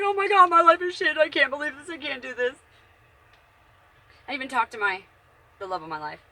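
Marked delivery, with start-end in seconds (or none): 0.0-2.5 high, loud imitation of her own crying fit; mock sobbing wail; retold wailing. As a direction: replay the breakdown, hammed-up despair fading at the end. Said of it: "dude, I was bawling for days".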